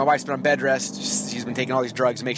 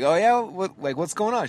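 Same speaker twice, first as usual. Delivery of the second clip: mock concern; 8 semitones higher, 3 dB louder than usual. Fake-cheerful reply to the cashier.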